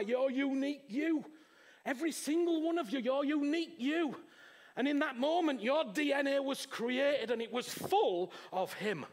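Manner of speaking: loud, high, building sermon; full-force preaching. Acted. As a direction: preach it out to the whole room.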